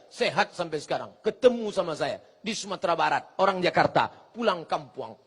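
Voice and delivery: ordinary talk, nothing marked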